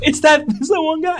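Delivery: mock excited recognition. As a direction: say it as if spotting a friend.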